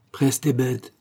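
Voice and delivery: short, businesslike urging; laconic order. A narrator's reading. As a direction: order the driver briefly. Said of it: "dit-il laconiquement au postillon".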